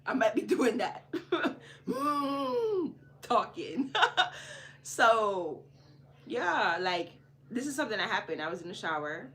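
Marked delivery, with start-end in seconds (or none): 1.1-2.9 a burst of high laughter. Real, not acted. Laughing at herself. 4.0-4.8 breathy laugh. Real, not acted. Laughing at herself.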